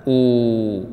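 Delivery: أو held as a steady long "oo"; long madd vowel. Shown as a demonstration. Teacher showing students the madd letters, long held "oo". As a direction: stretch أو into one sustained "uu" sound. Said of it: "بعكس المد".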